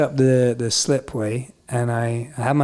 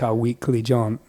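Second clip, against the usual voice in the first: ordinary talk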